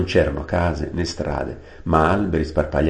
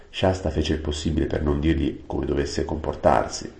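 ordinary delivery, nothing marked

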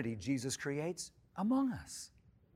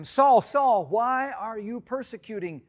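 high, loud call of Saul's name, then slower; voice of Jesus calling; 7 semitones higher, 7 dB louder, slower than usual. Acted. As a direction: call out Saul, Saul from heaven, then ask slowly. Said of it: "heard a voice saying to him".

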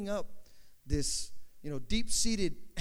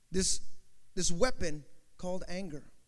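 normal talking voice

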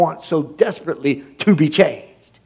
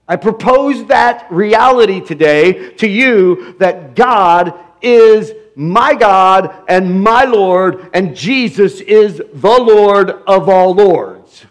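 slow, weighty and louder; slow proclamation; 3 semitones higher, 3 dB louder, slower than usual. Real, not acted. Preacher to congregation, slow weighty proclamation. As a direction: lay each word down deliberately.

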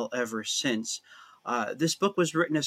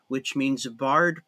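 normal talking voice

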